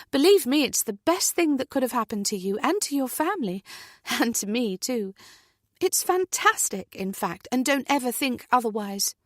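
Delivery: bright, emphatic encouragement; cheering her up warmly; enthusiastic. Acted. Natalie enthusiastic reassurance.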